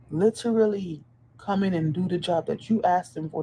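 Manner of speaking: normal talking voice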